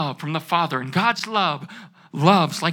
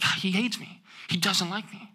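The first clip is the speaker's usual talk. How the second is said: put-on hushed, wounded child's thought; quiet hurt; 9 dB quieter than usual. Voicing a child's hushed hurt about an earthly dad. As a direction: say it under your breath, hurt.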